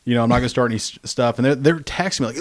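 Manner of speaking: ordinary delivery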